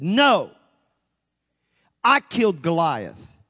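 firm, loud rebuke; loud stern rebuke from God; God's rebuking tone. Retold or imitated. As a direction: correct him with divine authority. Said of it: "That was the tone he used".

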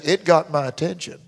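ordinary talk, nothing marked

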